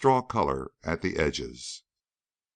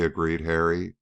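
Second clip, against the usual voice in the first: plain reading voice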